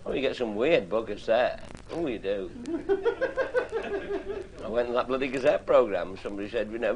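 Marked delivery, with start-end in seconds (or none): 2.5-4.6 high laugh. Real, not acted.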